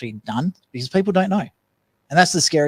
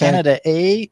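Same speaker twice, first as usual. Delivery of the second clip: hammed-up Canadian accent, rising eh; bad Canadian accent joke; 4 semitones higher, 6 dB louder than usual. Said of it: "your terrible Canadian accent".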